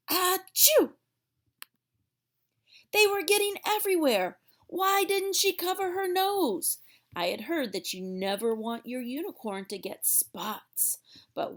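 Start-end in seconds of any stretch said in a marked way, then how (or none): 0.1-0.9 big loud high achoo; loud sneeze. Acted. Unicorn sneezing spots everywhere, loud high achoo. 2.9-6.8 higher, louder, fast panicked exclaiming. Acted. Girl dodging sneezed spots, high fast alarm.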